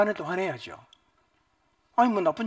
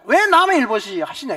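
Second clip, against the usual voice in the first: louder, slow bitter reproach; indignant sons; 7 semitones higher, 8 dB louder than usual. Retold or imitated. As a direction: scold the father for indifference. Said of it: "이거예요".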